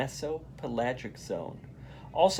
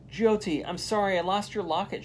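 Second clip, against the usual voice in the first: upset apology; 7 semitones higher than usual. A narrator's reading. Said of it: "she cries".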